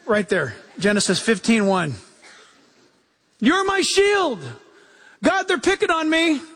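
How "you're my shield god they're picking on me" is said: mock plea to God. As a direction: play a kid tattling to God, hammed-up. Pleading to God in mock whine.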